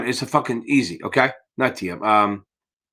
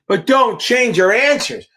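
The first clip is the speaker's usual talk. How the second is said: slow, much higher, pressing "don't"; emphatic warning; 8 semitones higher, slower than usual. Real, not acted. Tutor warning a student off changing answers, slow and emphatic. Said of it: "urging student not to change answers".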